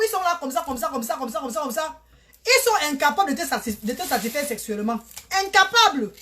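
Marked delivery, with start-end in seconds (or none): 5.4-6.1 emphatic exclamation. Real, not acted. Ranting about European men.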